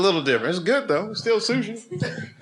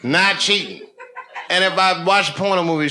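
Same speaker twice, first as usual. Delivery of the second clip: louder, higher laughing rant; loud laughing protest; 7 semitones higher, 7 dB louder than usual. Real, not acted. Defending himself to the crowd, loud and laughing. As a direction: protest loudly while laughing.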